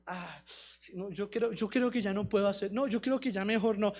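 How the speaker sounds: low, soft, hesitant giving-up; mock defeatism. Imagined Joshua begging off the task, mock defeated mumble. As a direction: mumble it like someone backing out. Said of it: "Josué no se quedó diciendo".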